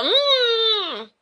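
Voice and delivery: high muffled squeal through a gag; muffled cry. Acted. Sirius gagged by a sock, squeals a muffled protest. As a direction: protest with a sock in your mouth.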